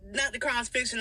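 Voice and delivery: normal talking voice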